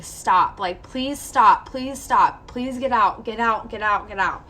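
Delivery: repeated begging that eases off toward the end; retold screaming plea. Patient retells begging doctor to stop, mock-screamed plea. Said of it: "And I'm, like, screaming".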